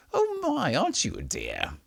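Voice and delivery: high, cooing greeting; high sweet old lady. Acted. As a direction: coo like a kindly granny. Voicing a friendly woman.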